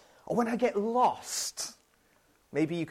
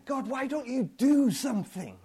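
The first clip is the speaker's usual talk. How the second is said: fed-up, demanding question to God; exasperated demand; 5 semitones higher than usual. Retold or imitated. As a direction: throw the question at God in frustration.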